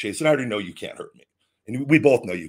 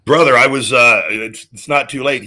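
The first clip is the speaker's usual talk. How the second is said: much louder, higher burst of eagerness; excited outburst; 4 semitones higher, 11 dB louder than usual. Real, not acted. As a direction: jump in loudly. Reacting loudly to co-host.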